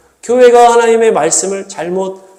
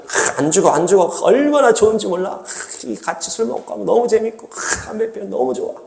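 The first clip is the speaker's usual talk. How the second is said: hushed, coaxing devil voice; the devil seducing, soft and sly; tempting devil; 3 semitones higher, 6 dB quieter than usual. Acted. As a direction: lure them in softly, savouring each pleasure.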